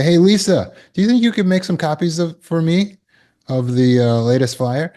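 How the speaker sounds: high, fast eager request; coworker asking. Retold or imitated. Coworker breezily asking Lisa for copies. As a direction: breezily ask a favor. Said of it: "They would say".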